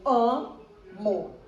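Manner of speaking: "Amo" split in two, AM, pause, MO; syllable separation. Shown as a demonstration. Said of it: "você tem o radical am e você tem o mo".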